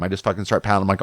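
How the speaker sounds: normal talking voice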